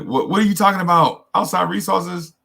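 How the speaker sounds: high, incredulous imitation of a friend; mock disbelief. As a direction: scoff like it's absurd. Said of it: "it sounded funny to them".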